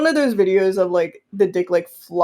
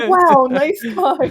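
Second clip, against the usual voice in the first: slow, high, louder-than-natural impressed wow; 7 semitones higher, 4 dB louder, slower than usual. Retold or imitated. Retelling their first instinct on seeing it, impressed. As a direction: draw it out in open admiration. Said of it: "my instinct was going".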